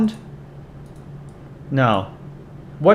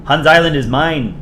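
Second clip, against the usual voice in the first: put-on louder, emphatic Canadian accent; 3 semitones higher, 5 dB louder than usual. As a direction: declare it firmly in a Canadian accent. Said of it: "I should do my Canadian accent".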